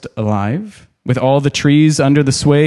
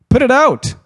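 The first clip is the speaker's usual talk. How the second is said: high panicked shout of put it out; trapped hobbit screaming from inside a tree; desperate cry; 12 semitones higher than usual. Acted. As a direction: yell in terror to stop the fire. Merry trapped, crying for help.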